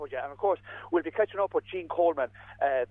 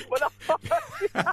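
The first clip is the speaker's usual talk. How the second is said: slow, higher, laughing repeat; laughing disbelief; much slower than usual. Real, not acted. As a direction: drag it out, laughing at yourself. Recalls interviewing a horse.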